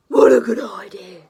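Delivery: loud, lightly voiced eager dragon; Zog the dragon. Zog eagerly accepting the girl's offer, loud. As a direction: answer like a keen young dragon. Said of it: "said Zog".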